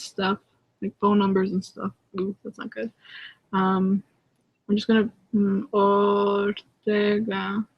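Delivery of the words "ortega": Spanish accent. Shown as a demonstration. Reading out the singer's name, lightly Spanish accent. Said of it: "saying it in a Spanish accent".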